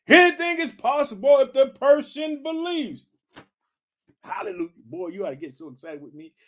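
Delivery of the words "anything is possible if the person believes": Jesus proclaiming, higher. Retold or imitated. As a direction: pitch lifted high and loud on "Anything is possible". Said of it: "Jesus asks".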